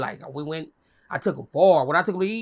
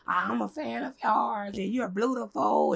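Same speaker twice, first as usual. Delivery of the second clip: imitating admirer; 5 semitones higher, 4 dB quieter, slower than usual. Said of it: "He's like".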